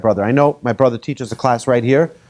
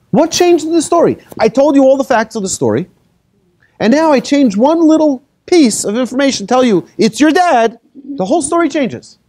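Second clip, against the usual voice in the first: much higher, driving sermon voice; fervent preaching; 10 semitones higher than usual. Acted. Rabbi pressing the congregation on the story, fervent preaching. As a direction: build the point with rising fervour.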